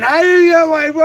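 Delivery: squeaky high mocking whine, then it drops; mock whiny complainer. Ranting streamer mocks whiny viewers in a high squeak. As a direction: parody the crybabies on YouTube, high-pitched. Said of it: "But I hear people fucking crying".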